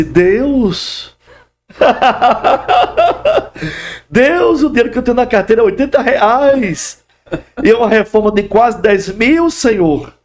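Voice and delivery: overwhelmed, half laughing, half weeping to God; tearful laughing prayer. Retold or imitated. Pastor retelling his overwhelmed prayer over the renovation cost, high and laughing. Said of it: "E eu saí ali chorando".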